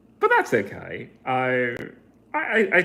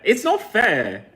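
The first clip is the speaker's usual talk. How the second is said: mock whine; 6 semitones higher, 6 dB louder than usual.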